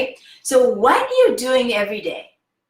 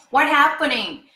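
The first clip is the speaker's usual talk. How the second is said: ordinary delivery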